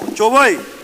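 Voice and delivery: loud, high call with a falling end; rallying call. Real, not acted. Moderator greets the forum with a shouted rallying call. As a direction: call it out to rouse the room.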